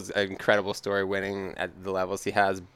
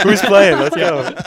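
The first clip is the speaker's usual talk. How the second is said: high, loud call with a laugh; rally the table for a bet; rallying teammate; 8 semitones higher, 10 dB louder, slower than usual. Acted. Joking as a teammate at dinner, loudly rallying everyone.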